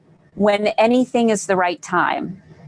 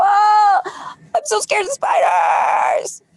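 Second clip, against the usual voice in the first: mock scared cry; 11 semitones higher, much slower than usual. Coach repeating spider fear for viewers, high mock cry. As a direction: cry it out in play, a bit less wild. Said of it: "the next time the spider triggers, you go".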